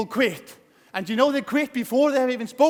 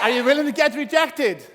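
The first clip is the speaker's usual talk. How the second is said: rallying shout; 5 semitones higher than usual. Real, not acted. Speaker to audience, firing them up, rousing shout. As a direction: whip the audience into shouting back. Hyping crowd with call-and-response.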